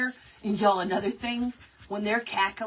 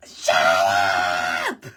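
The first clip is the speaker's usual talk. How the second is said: long, harsh full-throated scream; loud demonstration screech; 6 dB louder than usual. Shown as a demonstration. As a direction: scream it to stop the cackling. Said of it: "I scream and".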